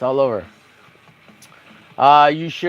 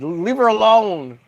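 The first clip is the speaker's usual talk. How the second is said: high, drawn-out protest; mock indignation; 5 semitones higher than usual. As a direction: whine in defence of the drawing. Mock defending the drawing.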